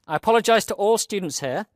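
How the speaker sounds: ordinary talk, nothing marked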